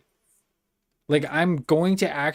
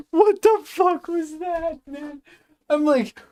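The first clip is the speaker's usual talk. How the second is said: much higher, laughing through the words; laughing squeal; 13 semitones higher, 4 dB louder, slower than usual. Real, not acted. Streamer laughing at his own audio mistake, high and giggly. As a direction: crack up in disbelief.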